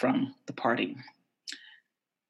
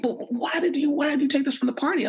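pitched way up, quick and prodding; mock high police question; 11 semitones higher, faster than usual. Police officer asks why she took the weed, high and fast. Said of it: "they're like".

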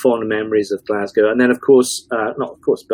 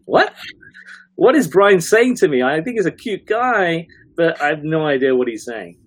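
put-on high, baffled young woman voice; bewildered bridesmaid; 7 semitones higher than usual. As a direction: play her utterly lost.

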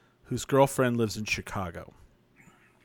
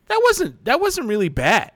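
much higher, louder mock surprise; surprised relief; 10 semitones higher, 6 dB louder than usual. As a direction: imitate her pleased shock. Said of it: "She goes".

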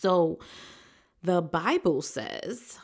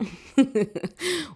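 light laugh; 7 semitones higher, 5 dB louder than usual. Real, not acted. Laughs at herself.

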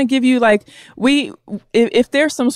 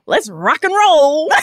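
higher, slow, rousing call; 6 semitones higher, slower than usual. Acted. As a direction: stretch it out like a cheesy send-off.

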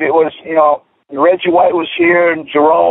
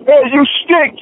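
mock heckle; 9 semitones higher than usual. Fans heckling him.

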